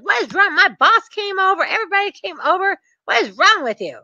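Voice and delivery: high-pitched mock indignation; mad bank rep. Bank rep embarrassed before her boss, mock angry. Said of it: "she was so mad".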